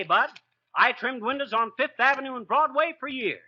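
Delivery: normal talking voice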